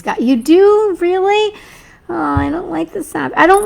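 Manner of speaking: can't believe it, ask back; rising, astonished really; surprised disbelief. Real, not acted. Surprised at a viewer's answer.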